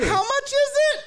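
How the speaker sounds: high-pitched blaring question; loud aunt. Retold or imitated. Aunt at the drive-thru window, loud high holler. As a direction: imitate an oblivious aunt hollering. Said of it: "she was just loud".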